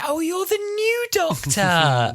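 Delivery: very high, slow gushing exclamation; thrilled star. Retold or imitated. Retelling Billie Piper greeting the costumed fan, thrilled. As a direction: imitate a star cooing with excitement. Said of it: "and she said".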